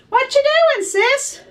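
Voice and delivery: high teasing big brother voice. Acted. Big brother teasing his sister, high and loud.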